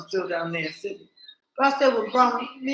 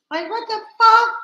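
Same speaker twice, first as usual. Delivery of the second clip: much higher, loud exclamation; outraged disbelief; 13 semitones higher, 9 dB louder than usual. Real, not acted. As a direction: shout it out in shocked outrage. Outraged reaction to France's terms.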